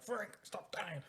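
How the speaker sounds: high, slow, drawn-out plea; mock exasperation. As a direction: imitate calling out to a hopeless teammate. Retold exasperated call to teammate.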